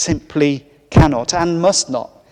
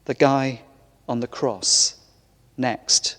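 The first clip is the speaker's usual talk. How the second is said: quiet closing line; 4 semitones lower, 6 dB quieter than usual. Acted. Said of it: "The man said".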